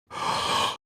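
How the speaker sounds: big intake of breath. Shown as a demonstration. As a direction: suck in a big breath like an announcer. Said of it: "If the announcer goes".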